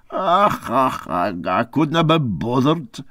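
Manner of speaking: lightly voiced gruff Scots skipper, hesitant; a hint of an old Scots sea captain brushing it off. Captain shrugging off the narrator's question, lightly voiced Scots.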